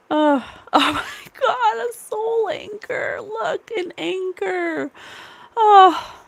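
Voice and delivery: high, tearful, slowed exclamations; overwhelmed and crying; real tears breaking through. Real, not acted. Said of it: "I'm crying".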